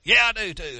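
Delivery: loud, high defiant snap; angry retort. Retold or imitated. Angry man by the road snapping back at the pastor. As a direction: bark back at the pastor stubbornly.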